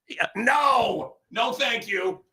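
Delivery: high, drawn-out scoffing no; mock emphatic refusal. Father refusing studies for his daughters, high mocking refusal. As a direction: hoot the refusal, then ease off.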